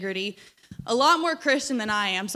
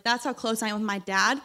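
usual speaking voice

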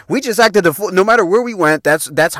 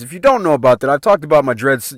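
ordinary delivery

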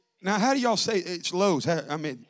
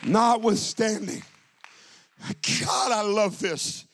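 ordinary talk

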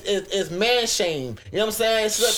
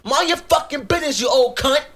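retold yell at the old lady, louder and higher; angry shout; 6 semitones higher, 5 dB louder than usual. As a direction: snap back at her hard. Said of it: "I looked at her like".

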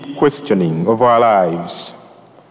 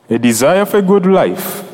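ordinary talk, nothing marked

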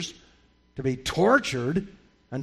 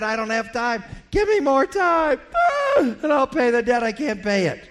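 much higher and louder pleading for more time; desperate begging; 7 semitones higher, 3 dB louder than usual. Acted. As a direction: beg at his feet. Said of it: "the man fell at his feet and said".

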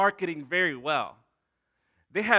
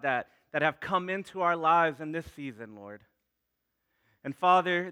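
softer, lower prayer with pauses between phrases; heartfelt prayer; 3 semitones lower, 6 dB quieter than usual. Real, not acted. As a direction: pray aloud to God, unhurried, phrase by phrase. Leads the congregation in prayer.

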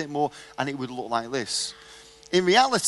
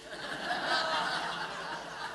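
breathy laugh; 9 semitones higher, 11 dB quieter than usual. Real, not acted. Reaction to the punchline.

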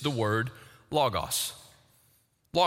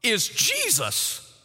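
higher, ringing sermon voice; full-force preaching; 7 semitones higher than usual. Acted. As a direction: preach it out with conviction.

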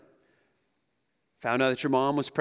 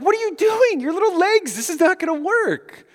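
much higher mock-alarmed parent voice; exasperated parent; 13 semitones higher, 4 dB louder than usual. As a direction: scold the toddler, half-laughing.

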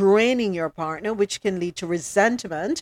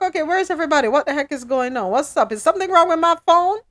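high, rapid-fire retold panic; worked-up frustration; 8 semitones higher, 4 dB louder, much faster than usual. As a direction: act out fretting as calls go unanswered, pitch and volume climbing. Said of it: "getting all worked up".

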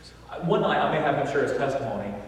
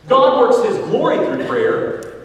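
loud, high, slowed proclamation; preached repetition; 10 semitones higher, 9 dB louder, slower than usual. Shown as a demonstration. Preacher hammering his point to the church, loud and slow. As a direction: belt it out to the back pew. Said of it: "repeats the point".

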